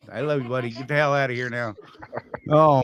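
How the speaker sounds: ordinary talk, nothing marked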